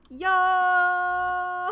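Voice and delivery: loud, high hype shout; loud exclamation. Real, not acted. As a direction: shout it out hyped.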